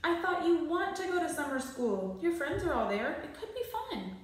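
coaxing mother. A narrator's reading. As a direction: sell it sweetly to a sulky son.

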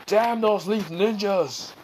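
high, slow mock fury; angry curse. Raikage cursing the invaders.

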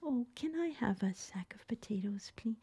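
whispered polite request for potatoes; gentle request. Shown as a demonstration. Shopper asking for potatoes in a soft whisper. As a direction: breathe the request softly and politely. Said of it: "we don't say".

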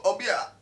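"Obia" blurted high and loud as a guess; trying out the name. Shown as a demonstration. Guessing aloud how to say the dealer's name, loud and high. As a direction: call out "Obia" with a bright, loud stab at the name. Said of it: "I think it, Ho".

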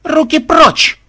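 very high, loud bark; shrieked hands-off order. Acted. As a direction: snap it out as a sharp command. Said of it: "рявкнула Мелисса".

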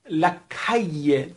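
calle said slowly as kaye; teacher models calle slowly as kaye; double l as y. Shown as a demonstration. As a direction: stretch calle, ll like y.

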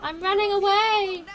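high, loud, stretched cry; drawn-out high holler; fleeing yell. Real, not acted. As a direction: shout it while making your escape. Announcing escape during a fight.

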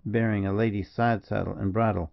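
plain reading voice

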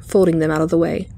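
plain reading voice

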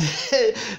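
short high laugh; a real chuckle mid-sentence. Real, not acted. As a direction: break into a quick laugh. Preacher amused at own example.

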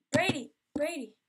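loud desperate yell of the name; screaming name. Retold or imitated. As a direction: yell at him to wake up. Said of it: "I'm screaming".

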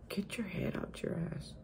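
hushed unvoiced scolding; soft whispered inner voice; whispered self-rebuke. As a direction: whisper it to yourself like a harsh truth.

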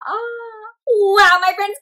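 ordinary talk

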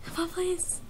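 usual speaking voice

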